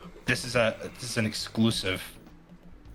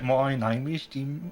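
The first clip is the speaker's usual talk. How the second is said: slow, higher Australian twang on "My name is Jim"; Australian accent; 4 semitones higher, slower than usual. Retold or imitated. The creator repeats his cartoon character's line in an Australian accent. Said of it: "It's Australian".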